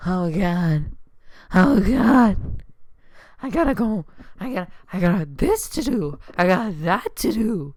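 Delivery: loud, frantic retold worry; loud, hammed-up fretting; mock panic. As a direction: act out waking up late in a panic. Retells waking up in panic.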